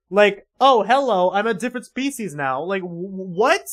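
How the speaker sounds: loud, high-pitched disbelief; incredulous outburst. Real, not acted. Outburst reacting to the story.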